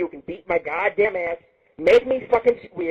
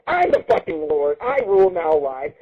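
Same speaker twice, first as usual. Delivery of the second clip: normal talking voice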